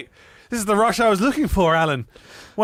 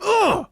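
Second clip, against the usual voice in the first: high grunt of pain; 13 semitones higher than usual. Acted. Ross pistol-whipped in the face, pained grunt. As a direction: grunt as if hit. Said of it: "pistol whips Ross in the face".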